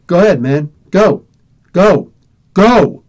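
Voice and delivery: loud, punched single-word orders; mock punk's pushy dismissal; curt command. Acted teen ordering him away.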